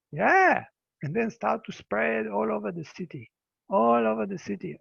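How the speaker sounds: ordinary delivery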